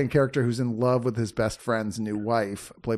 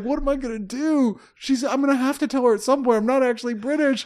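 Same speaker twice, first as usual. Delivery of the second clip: high, whiny panic, hammed up; panicked friend; 12 semitones higher, 3 dB louder than usual. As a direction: play the fraud who's trapped by his lie. Imitating panicked fake Brit friend.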